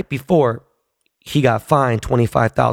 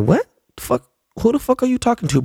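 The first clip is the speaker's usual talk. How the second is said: high, incredulous comeback; retold confrontation; 11 semitones higher than usual. Fan squaring up to a player, incredulous. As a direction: challenge him with pitch raised, not volume.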